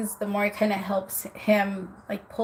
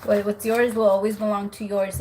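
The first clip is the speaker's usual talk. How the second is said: ordinary talk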